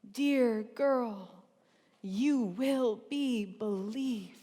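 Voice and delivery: rhythmic poem, hushed refrains against driving surges; spoken-word poetry. Acted. Poet urging girls to rise and be believed, spoken-word performance. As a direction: perform it as slam poetry, building to a rallying finish.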